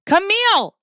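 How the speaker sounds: loud high call, falling at the end. Real, not acted. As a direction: call her name across the room. Calling out a chatter.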